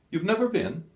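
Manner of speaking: probing officer question. A narrator's reading. Kesselring questions Scholl.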